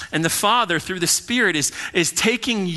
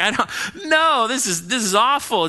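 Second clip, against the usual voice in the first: high, louder outburst of distaste; mock protest; 8 semitones higher, 4 dB louder than usual. Churchgoer with no appetite for the Bible, mock disgusted protest. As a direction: act out someone who can't stand it. Acts out someone hating church.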